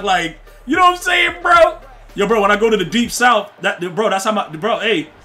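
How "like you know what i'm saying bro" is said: high laughing shout. Real, not acted. Streamer hyped up teasing chat, shouting high. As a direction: yell it up high while cracking up.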